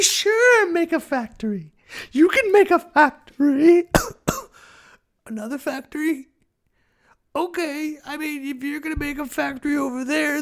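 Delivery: very high, fluting majestic falsetto; grand lady trying to stay gracious about factories; hammed-up high dainty voice; Mother Earth. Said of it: "She used to sound like this".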